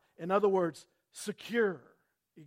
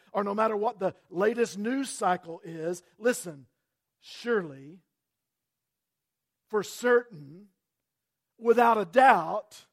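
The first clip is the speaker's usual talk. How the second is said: building, louder preaching; 3 semitones higher, 8 dB louder than usual. Acted. Pastor urging the church to trust, rising louder. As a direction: press the certainty home.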